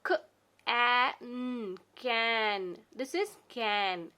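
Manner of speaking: letter by letter, then the word; sounded out slowly. Shown as a demonstration. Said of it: "for example".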